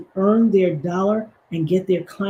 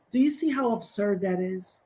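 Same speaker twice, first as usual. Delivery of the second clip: usual speaking voice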